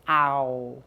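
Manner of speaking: "Ow" held long as a lone vowel sound. Shown as a demonstration. Teacher showing the sound of a slider, ow sound. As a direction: model "Ow" low and drawn out, gliding from ah to oo. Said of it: "And the ow sound".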